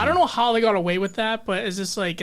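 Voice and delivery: ordinary talk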